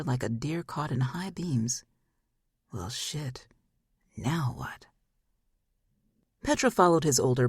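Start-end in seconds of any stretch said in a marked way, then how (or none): 2.7-4.9 softer, almost whispered dismay; a whispered well, now what; hushed aside. Acted. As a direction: mutter it under your breath. Phoenix's stunned inner thought.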